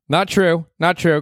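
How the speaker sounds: emphatic denial. Real, not acted. Denying feedback emphatically.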